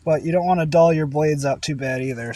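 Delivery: ordinary talk, nothing marked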